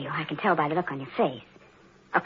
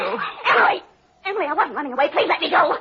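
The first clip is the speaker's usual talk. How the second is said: frightened pleading; 8 semitones higher than usual. Acted. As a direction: plead to be let go. Daisy caught running away.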